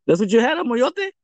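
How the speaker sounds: disbelieving. Real, not acted. Asking his friend in disbelief about the remedy, incredulous rise. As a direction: pitch jumps up in disbelief.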